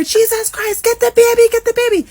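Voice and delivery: loud, shrieked Trinidadian alarm; Trini accent. Retold or imitated. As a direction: imitate frantic high-pitched yelling in a Trini accent. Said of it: "this is a Trini accent".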